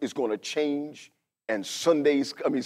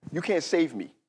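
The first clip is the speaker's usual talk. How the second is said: ordinary talk, nothing marked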